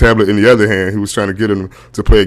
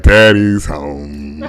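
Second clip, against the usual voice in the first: low, long-drawn imitation of the kids; deep, slow mock greeting; kids' greeting; 7 semitones lower, much slower than usual. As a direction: drag it out low, mocking the kids. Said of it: "My kids are like".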